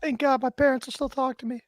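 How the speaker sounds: very high put-on falsetto; mock squeaky voice. Joking as if in the Brawny guy sketch, high silly voice. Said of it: "Is that how the Brawny Paper Towel Guy talks?".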